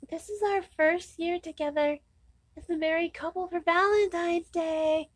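high, soft, syrupy newlywed voice; put-on high sing-song sweetheart voice; mock lovey-dovey couple. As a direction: squeak it softly, cooing in mockery. Mocking lovey young couple's sweet talk.

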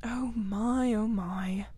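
woeful, fading murmur; miserable. A narrator's reading. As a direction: moan mildly over your hurt. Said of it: "said the mole miserably".

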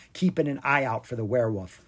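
usual speaking voice

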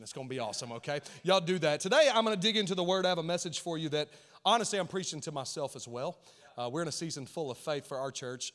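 ordinary talk, nothing marked

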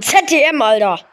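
ordinary delivery

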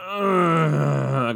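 a hammed-up ox grunt; mock ox grunt. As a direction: grunt like a beast in effort, played for laughs. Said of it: "like a fucking ox".

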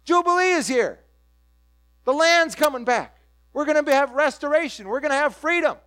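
preacher voicing the hoped-for Jubilee, triumphant; jubilant proclamation. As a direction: proclaim it like good news shouted. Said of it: "And he says".

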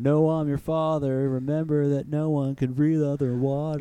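a hint of a solemn God. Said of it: "God said to man".

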